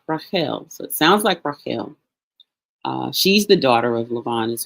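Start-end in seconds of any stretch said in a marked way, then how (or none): 0.1-2.0 Rachel as rah-KHEL, throaty kh, last syllable stressed; Hebrew pronunciation. Shown as a demonstration. Teacher putting the sounds together, Rachel as rah-KHEL. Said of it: "So it comes out Rachel".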